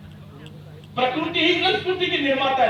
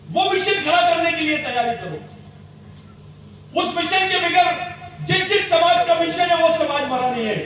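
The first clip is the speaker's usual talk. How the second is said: booming rousing general speech; 5 semitones higher, 3 dB louder than usual. Real, not acted. Rousing rally speech, raised voice.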